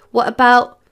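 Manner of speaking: insistent start of a question; urgent blurt. A narrator's reading. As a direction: blurt it out before being stopped. Boy blurts, cut off by teacher.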